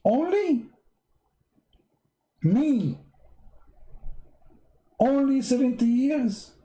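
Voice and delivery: high, indignant mock disbelief; mock outrage. Would-be convert complaining about the reward, high mock disbelief. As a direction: act out a convert who feels cheated. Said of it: "I'm very upset".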